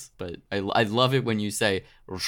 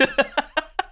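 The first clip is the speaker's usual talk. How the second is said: a quick high burst of laughter. Real, not acted. Laughing at her own tired self while recalling the chat.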